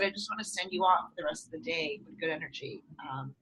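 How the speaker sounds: soft breathy whisper; whispered kind send-off. Retold or imitated. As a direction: hush it like a secret blessing. Sending stranger off kindly.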